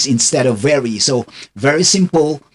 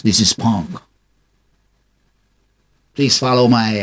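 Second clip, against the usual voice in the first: ordinary talk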